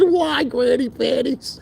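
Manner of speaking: high, slow, drawn-out mock question; mocking high drawl. Teasing the older woman about her outfit, high mocking drawl. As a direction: stretch it out high to tease her. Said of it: "I said".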